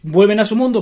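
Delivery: ordinary talk, nothing marked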